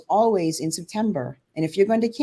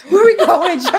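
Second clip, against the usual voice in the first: mock distress; 8 semitones higher, 11 dB louder than usual. Playful mock distress at husband.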